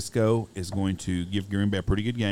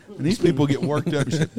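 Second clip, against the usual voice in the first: normal talking voice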